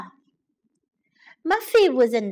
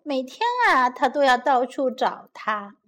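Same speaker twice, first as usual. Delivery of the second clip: plain reading voice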